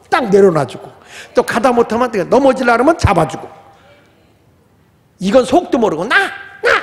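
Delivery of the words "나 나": put-on toddler insisting.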